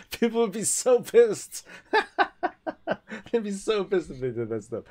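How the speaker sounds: giggling, can barely get it out; high laughing delight. Real, not acted. Laughing at imagined fan outrage.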